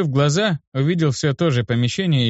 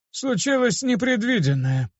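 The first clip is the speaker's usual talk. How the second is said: put-on higher-pitched, creaky old man, sorrowful; creaky and sad; 12 semitones higher than usual. A narrator's reading. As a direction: break bad news in a sad, creaky old voice. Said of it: "скрипучим, но в то же время грустным голосом". (скрипучий, грустный голос старика)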